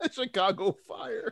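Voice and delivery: slow, high, laughing through the words. Real, not acted. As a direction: say it while cracking up. Laughs mid-banter.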